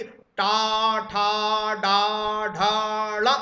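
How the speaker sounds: tongue flips back on each one; retroflex letters drilled. Shown as a demonstration. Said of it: "बोल कर देगी". (मूर्धन्य अक्षर ज़ोर से अलग अलग बोलना)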